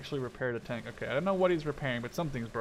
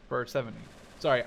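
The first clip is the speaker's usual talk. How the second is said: ordinary delivery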